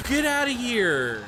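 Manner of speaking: high, slow, drawn-out yell; frantic yell. Real, not acted. Streamer yelling at a monster at the door, high and drawn out. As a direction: shout the monster away.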